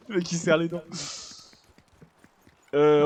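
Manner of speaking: ordinary talk, nothing marked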